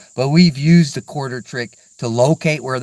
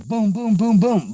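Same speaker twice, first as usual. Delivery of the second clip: imitated gunshots; 8 semitones higher than usual. Shooter retelling his rifle fire, high mock booms.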